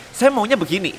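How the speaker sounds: mock whiny insistence, pitched high; self-centred client. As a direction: imitate a stubborn client who only wants his way.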